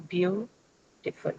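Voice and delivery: Beautiful said slowly with the weight on BEAU; slow teacher demo of Beautiful, BEAU stressed. Shown as a demonstration. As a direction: lean into the first syllable of Beautiful, slowly. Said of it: "on the first syllable, which is beau".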